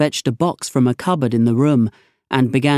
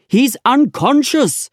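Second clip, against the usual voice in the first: cried angrily; 10 semitones higher, slower than usual. Acted. Inspector discovering prisoner fainted, high angry cry. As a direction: yell it up high in alarm. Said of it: "he cried angrily".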